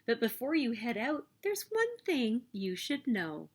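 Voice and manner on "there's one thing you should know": ordinary delivery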